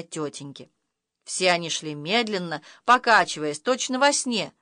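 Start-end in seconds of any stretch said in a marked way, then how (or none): none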